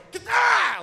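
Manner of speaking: high, loud sudden yell at the couple; startled shout. Retold or imitated. Comic imagines scaring the old couple, sudden loud yelp. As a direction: jump out and yell to scare them. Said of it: "I'm just gonna go".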